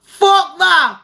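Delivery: loud, high-pitched dismissal; emphatic refusal. Real, not acted. Comic outraged refusal, high and loud.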